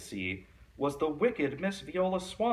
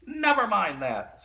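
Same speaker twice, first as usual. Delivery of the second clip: high, louder, sharp witchy snap; snapped; 10 semitones higher, 7 dB louder than usual. A narrator's reading. Miss Swamp shutting down the kids' question, sharp snap. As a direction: cut them off like a witch. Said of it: "snapped Miss Swamp".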